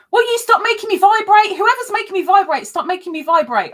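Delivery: high, loud, fast fluster; flustered real scolding; exasperated. Real, not acted. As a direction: shoo away whoever buzzes you. Phone buzzing, scolding caller.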